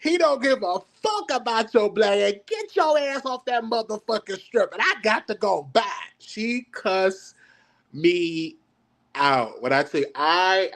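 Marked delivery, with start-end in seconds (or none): 0.0-4.7 mother cussing him out. Retold or imitated. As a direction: yell it like a mother cussing her son out. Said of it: "She said".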